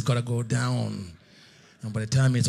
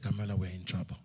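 scared hush; 6 semitones lower, 11 dB quieter, faster than usual. Retold or imitated. Scared whisper to wife.

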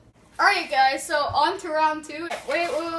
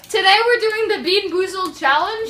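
excited; 4 semitones higher, 11 dB louder than usual. Real, not acted. Energetic vlog intro.

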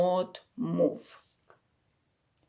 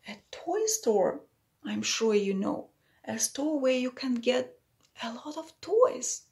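ordinary delivery, nothing marked

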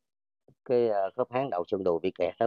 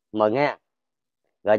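normal talking voice

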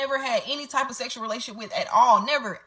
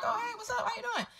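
high, softened hello voice; casual high-pitched hey, kept soft; retold friendly greeting; 13 semitones higher, 8 dB quieter than usual. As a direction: toss out a high, light greeting. Retold casual video-call greeting.

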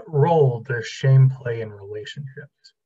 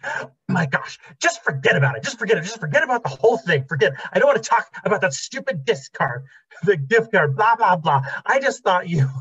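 higher, rising into a mock-irritated rant; exasperated wife; 6 semitones higher than usual. Wife waving off the gift card talk, mock exasperated. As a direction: brush him off like an annoyed wife, half laughing.

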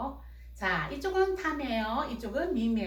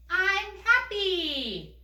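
put-on much higher, very slow child voice answering; 높은 아이 목소리; 7 semitones higher, much slower than usual. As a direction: answer slowly in a high little-girl voice. (미미 역할로 높고 천천히 대답하는 목소리)

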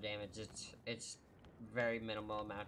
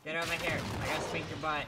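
loud teasing threat, higher; playful shout; 5 semitones higher, 6 dB louder, faster than usual. Pretend. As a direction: call out a mock threat, loud. Playful threat chasing teammate.

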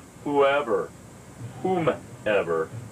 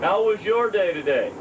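cheerful high voice; 10 semitones higher than usual. Pretend. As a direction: play the chirpy partner. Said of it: "start a tape something like this".

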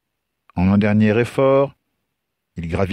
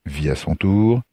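plain reading voice

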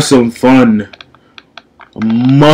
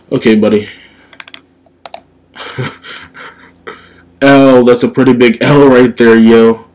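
normal talking voice

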